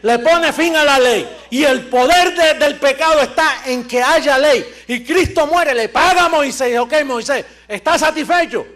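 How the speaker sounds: full-force preaching. Acted. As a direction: push the argument with fire.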